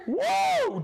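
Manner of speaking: high, loud cheer of delight; a real happy woo; excited whoop. Real, not acted. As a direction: whoop it out for a fan. Cheering a viewer shout-out.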